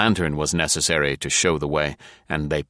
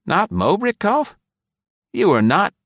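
astonished question; 12 semitones higher, 4 dB louder than usual. A narrator's reading. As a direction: ask it in sheer disbelief. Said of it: "he gasped".

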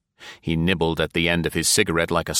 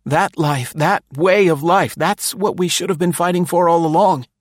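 high, earnest insistence; a touch of earnest pleading; earnestly; 12 semitones higher, 6 dB louder than usual. A narrator's reading. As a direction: insist with sincere conviction. Densham earnest conviction.

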